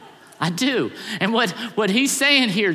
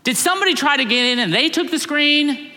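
pleading higher and louder, building to a near shout; rising plea; 6 semitones higher, 4 dB louder than usual. Retold or imitated. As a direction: push harder and harder, almost begging. Wife pleading, getting more insistent.

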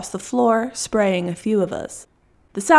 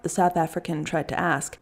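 ordinary delivery

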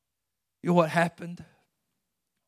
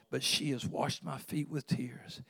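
hushed whisper; 2 semitones lower, 7 dB quieter than usual. Acted. Jesus tells Simon what the woman did, hushed whisper. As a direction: breathe the words, barely there.

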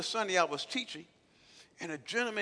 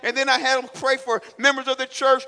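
preaching at full force; 5 semitones higher, 14 dB louder than usual. Real, not acted. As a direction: thunder it at the congregation.

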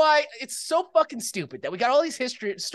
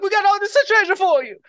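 knights announcing; 10 semitones higher, 6 dB louder than usual. Acted. Knights voiced to the Pope.

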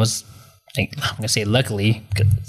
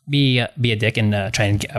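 ordinary talk

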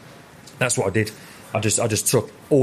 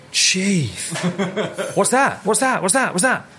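much higher, eager repeated what's that; thrilled high questioning; 10 semitones higher, slower than usual. Retold or imitated. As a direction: fire the questions out, wide-eyed and delighted. Chef thrilled by the new dish.

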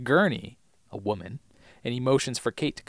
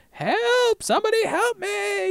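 hammed-up cry for help; mock scream; slower than usual. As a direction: screech it like a panicked patient. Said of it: "starts screaming".

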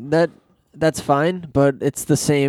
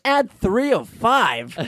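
mock announcer read; 9 semitones higher, much slower than usual. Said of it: "I was like".

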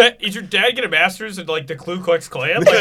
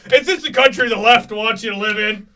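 mocking dad; 4 semitones higher, 7 dB louder than usual. Imitating dad's rant.

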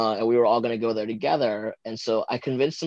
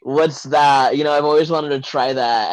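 excited; 4 semitones higher, 8 dB louder than usual. Retold or imitated. Flirty reaction to split tongue.

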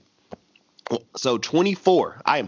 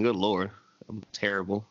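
ordinary delivery

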